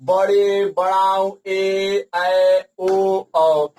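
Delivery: each vowel in बड़े, बड़ाऊ, ए, ऐ, ओ, औ held long. Shown as a demonstration. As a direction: list ए, ऐ, ओ, औ with every vowel stretched. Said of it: "इनमें ज्यादा time लगता है".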